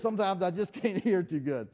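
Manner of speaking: usual speaking voice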